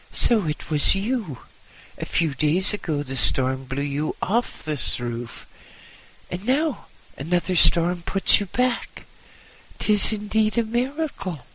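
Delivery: put-on high, loud amazed old monk; astonished simple monk. A narrator's reading. Monk Timon marvels at the boy's return, high and loud.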